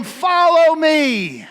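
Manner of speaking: loud, slow call across the water. Acted. Jesus calling out from the shore to the fishermen, loud and slow. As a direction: holler it out to the fishermen. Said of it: "he hollered out to him".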